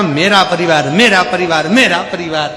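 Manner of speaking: acted scornful repetition, each one falling; mocking taunt. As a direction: throw the phrase back at him loudly. Guru throws man's words back.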